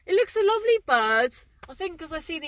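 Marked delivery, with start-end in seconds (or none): 0.1-1.3 cooing at a bird. Real, not acted.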